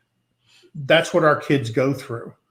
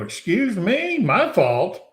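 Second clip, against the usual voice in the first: surprised; 6 semitones higher, slower than usual. Retold or imitated. Narrator to the instructor, shocked and offended. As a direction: pitch jumps on excuse me, offended disbelief. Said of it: "I go".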